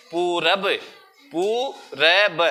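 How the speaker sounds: पूरब with a drawn-out poo; slow, sounded-out पूरब; long ऊ. Shown as a demonstration. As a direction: take extra time on पू in पूरब. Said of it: "थोड़ा सा ज्यादा टाइम लेते हैं बोलने में".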